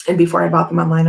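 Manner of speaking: ordinary talk, nothing marked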